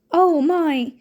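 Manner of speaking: put-on girl shocked at the slashed portrait; exclaiming. A narrator's reading. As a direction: let it burst out in alarm. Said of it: "Hermione exclaimed".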